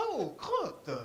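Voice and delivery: a hint of a prissy Frenchman; prim French voice. Now a proper Frenchman complaining about the cold. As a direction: mince the words politely. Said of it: "je dis".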